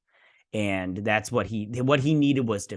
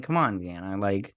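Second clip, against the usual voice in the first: usual speaking voice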